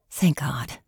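breathy whispered thanks; soft whispered thank god; whispered relief. A narrator's reading. Relieved inner thought.

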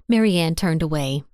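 ordinary delivery

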